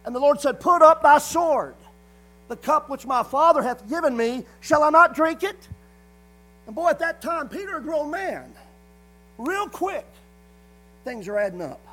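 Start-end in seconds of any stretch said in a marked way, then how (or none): 0.6-5.7 loud, commanding rebuke; preacher voicing the Lord's loud command; stern rebuke. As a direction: voice the Lord ordering him sharply. Lord's rebuke voiced in sermon.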